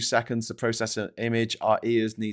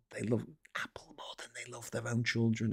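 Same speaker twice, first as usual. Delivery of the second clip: hushed mock-confidential whisper; a scandalous secret about Apple fans; 10 dB quieter than usual. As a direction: drop to a whisper for the punchline.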